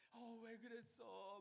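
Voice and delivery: high, slow, aching 왜 그랬어; pained tender. Retold or imitated. Paul grieving over the church, high pained tender voice. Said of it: "막 아파하면서 그렇게 했을".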